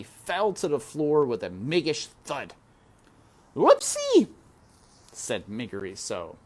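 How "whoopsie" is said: very high, loud yelp; Mig tumbling. A narrator's reading. Mig falling in front of the princess, high loud yelp. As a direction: cry out as you fall.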